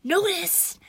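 loud, high sarcastic yell; yelling back. A narrator's reading. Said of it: "I yelled back".

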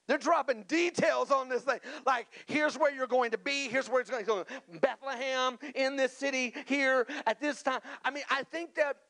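high-pitched, driving sermon delivery; animated preaching. Real, not acted. Preacher on the details of the Bethlehem prophecy, animated and high. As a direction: pile up the details with rising urgency.

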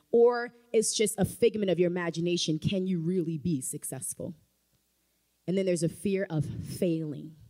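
ordinary talk

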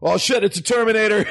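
high, hammed-up alarm; mock panic. John spotting a Terminator, mock panicked kid voice. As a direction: cry out in play-acted fright.

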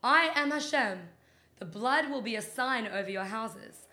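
slow, loud, booming voice of God; Hashem voice. Pretend. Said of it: "this is my Hashem voice".

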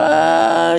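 high yelp of mock pain; mock cry of pain. Person scraping their nose on the ground, high mock yelp. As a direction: yelp as you hit the ground.

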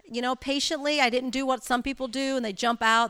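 usual speaking voice

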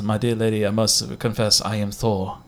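lightly voiced solemn Thor, low and soft; Thor in a joke. Retelling a joke about Thor confessing to lady, mildly solemn. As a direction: confess it in a mildly grand, hushed manner. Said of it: "and then he says".

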